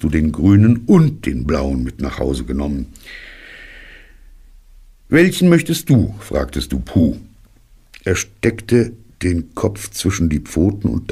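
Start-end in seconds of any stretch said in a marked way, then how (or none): 5.1-6.1 put-on bright high young boy's voice; high child voice. A narrator's reading. Narrator voicing the boy offering Pooh a balloon, high and eager. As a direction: ask it like an eager little boy.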